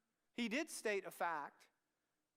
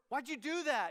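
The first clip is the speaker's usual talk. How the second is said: higher, louder mock-parent; accusing parent; 7 semitones higher, 8 dB louder than usual. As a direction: nag like a parent.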